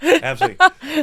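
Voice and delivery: high, loud laughing absolutely; cracking up at his own flirty joke. Real, not acted. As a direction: laugh the word out. Joking about seeing tattoo.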